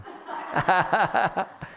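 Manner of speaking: a brief real laugh at his own boast; short chuckle. Real, not acted. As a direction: laugh it off lightly, self-mocking. Pastor chuckles at his own joke.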